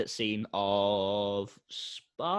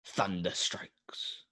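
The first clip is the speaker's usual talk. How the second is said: hushed trailer narration; 3 semitones lower, 7 dB quieter than usual. Acted.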